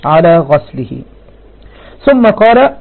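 usual speaking voice

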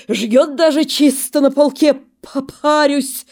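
хрипло; hoarse reassurance. Acted. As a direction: wheeze it out stubbornly. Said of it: "хрипит Косой".

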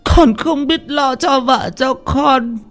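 put-on high shrill old woman's yell; old mother yelling. A narrator's reading. Old mother demanding her gold back from her son, yelling high. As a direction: scold like a frantic old mother. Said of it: "bà cụ giằng tay".

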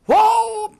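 startled exclamation of someone waking from a nightmare; sudden start. Retold or imitated. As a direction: jolt awake with a sudden cry. Sudden jolt in a dream story.

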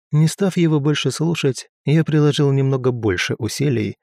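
usual speaking voice

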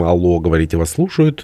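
plain reading voice

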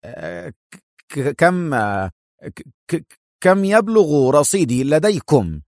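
stammering, faltering dread; halting, frightened stammer; increasingly trembling. Acted. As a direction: stutter fearfully, then force out the question slowly. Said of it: "بصوت زاد ارتعاده".

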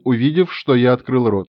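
ordinary delivery

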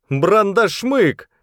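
high, drawn-out cry of alarm; a stretched, high-pitched shout. A narrator's reading. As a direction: call out the monster's name high and slow. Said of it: "крикнул кто-то".

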